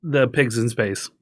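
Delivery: normal talking voice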